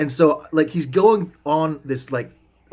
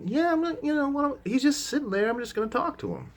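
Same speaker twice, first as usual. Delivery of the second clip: high mock reply; 13 semitones higher, 7 dB quieter than usual. Replying to whispered plea.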